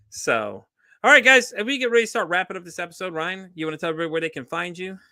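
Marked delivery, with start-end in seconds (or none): 1.0-2.6 much higher, louder call to the audience; bright, raised announcer-style call; upbeat host sign-off. Real, not acted. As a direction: perk up to wrap the show. Host starts wrapping up episode.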